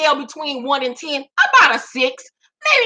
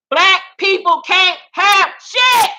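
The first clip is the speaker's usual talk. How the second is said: higher, louder, slow shouted outrage; outraged shout; 5 semitones higher, 4 dB louder, slower than usual. Real, not acted. Ranting to viewers about [name], outraged shout. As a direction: bellow it at the top of the lungs.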